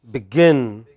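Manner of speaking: light be-, strong -GIN in Begin; final-syllable stress. Shown as a demonstration. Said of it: "bring that distinction very clearly".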